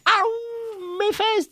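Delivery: very high comic yelp; nuage qui a mal. Acted. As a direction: squeal in mock pain. (petit cri aigu de douleur comique)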